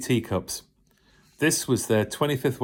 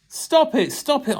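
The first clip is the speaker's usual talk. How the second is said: high-pitched begging stop it; cried out; 13 semitones higher than usual. Acted. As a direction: plead for it to stop. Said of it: "I cried".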